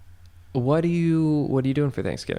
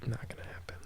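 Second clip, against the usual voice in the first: whispered aside; 16 dB quieter, faster than usual. Real, not acted. Aside after twenty-buck offer.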